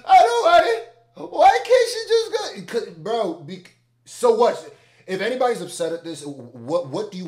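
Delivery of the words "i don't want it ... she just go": whiny complainer. Retold or imitated. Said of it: "if somebody be like".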